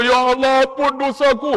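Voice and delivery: put-on old man begging the ustaz to forgive his sins; pleading pilgrim. Pilgrim prays aloud.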